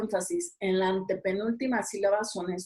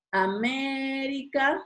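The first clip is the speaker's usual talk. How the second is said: América drawn out slowly, MÉ raised and held; stress on MÉ; 4 semitones higher, much slower than usual. Shown as a demonstration. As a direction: stretch América, leaning hard on the MÉ. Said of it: "antepenúltima". (América lento, marcando y alargando la MÉ)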